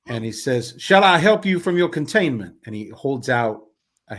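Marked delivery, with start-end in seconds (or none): 0.8-2.6 high, loud, courtly offer; theatrical carnival man. Acted. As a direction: offer help like a grand showman. Carnival man offers his hand.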